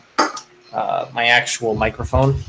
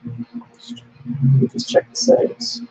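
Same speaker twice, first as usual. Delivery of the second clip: underwater; 11 semitones higher than usual. Real, not acted. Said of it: "Audio sounds like you're underwater".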